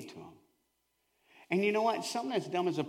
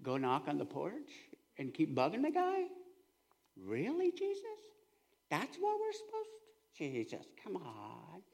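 hushed, doubtful disciple grumbling; lightly voiced puzzled follower; bewildered disciples; 4 semitones lower, 9 dB quieter than usual. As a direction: mutter in disbelief at Jesus. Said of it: "looking at him like deer in the headlights".

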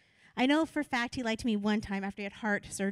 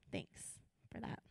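wry whisper; soft unvoiced thanks; whispered aside; 4 semitones lower, 15 dB quieter, slower than usual. Real, not acted. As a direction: mutter it under the breath.

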